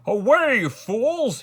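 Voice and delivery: high, loud royal dismissal; angry shout. A narrator's reading. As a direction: shout them out of the room. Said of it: "he shouted".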